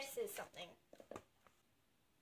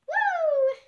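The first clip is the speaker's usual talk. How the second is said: excited whoop; 14 semitones higher, 11 dB louder than usual. Real, not acted. Reader celebrating.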